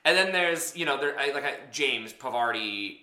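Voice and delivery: normal talking voice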